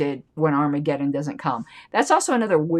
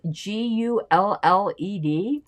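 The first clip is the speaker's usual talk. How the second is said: slow, letter by letter, puzzled; slow puzzled spelling of each letter; spelled out; slower than usual. Shown as a demonstration. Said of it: "spelling the word out".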